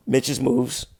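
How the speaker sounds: usual speaking voice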